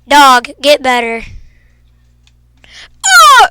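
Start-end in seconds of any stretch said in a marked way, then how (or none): 3.1-3.5 very high, loud oh; startled by a zombie, yelp; surprised yelp. Real, not acted. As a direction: jolt at a sudden shock. Sudden in-game surprise.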